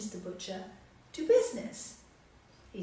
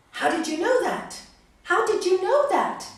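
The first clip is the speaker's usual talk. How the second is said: loud, higher astonished question; shocked disbelief; 9 semitones higher, 9 dB louder than usual. Acted. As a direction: blurt it out in shock. Monk shocked she knows.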